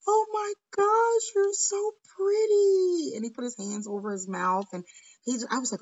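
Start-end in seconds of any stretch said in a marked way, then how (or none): 0.1-3.1 high, drawn-out mock gush; imitating overwhelmed admiration. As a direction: gush slowly, imitating an awestruck boy, pitched way up. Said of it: "He was like".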